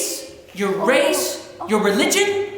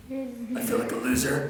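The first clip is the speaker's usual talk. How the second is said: small, soft mock-dejected voice; quiet, sheepish admission in a retold scene; dejected kid; 8 dB quieter than usual. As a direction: answer softly like a defeated child. Voices his sad younger self answering.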